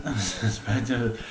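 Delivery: ordinary talk, nothing marked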